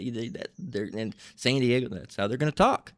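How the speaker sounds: normal talking voice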